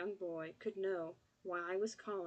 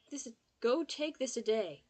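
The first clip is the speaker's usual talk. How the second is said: firm order; 4 semitones higher, 7 dB louder than usual. Acted. Woman ordering a child.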